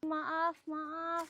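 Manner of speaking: hushed, subdued sorry; sheepish apology. Acted. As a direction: apologise meekly. Apology for being late.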